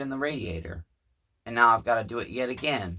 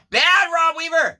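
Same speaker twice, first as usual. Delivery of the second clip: high, loud playful scold; teasing reprimand; mock scolding; 7 dB louder than usual. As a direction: scold him like a naughty pet.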